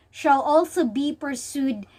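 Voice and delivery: ordinary talk, nothing marked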